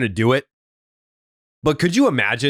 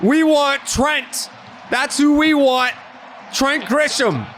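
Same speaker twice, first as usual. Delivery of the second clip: high, shouted taunting at the crowd; hollered gloating rant while rounding the bases; mock triumphant yell; 13 semitones higher, much slower than usual. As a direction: yell it at the booing fans. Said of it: "being like".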